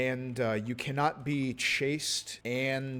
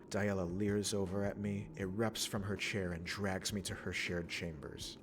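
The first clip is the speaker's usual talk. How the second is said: softer, measured narration of the scene; story narration; 4 dB quieter than usual. Acted. Streamer reading the game event aloud, soft narration.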